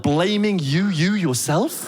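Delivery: ordinary talk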